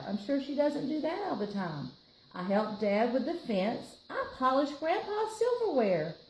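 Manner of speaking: plain reading voice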